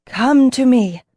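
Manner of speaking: a dragon calling the others to it; commanding bellow. A narrator's reading. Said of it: "It bellowed commandingly".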